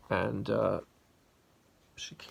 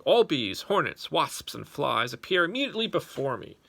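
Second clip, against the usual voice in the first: louder, higher royal command, rushing at the end; furious command; 5 semitones higher, 8 dB louder than usual. Acted. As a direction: summon every insect in fury. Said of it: "he commanded with fury".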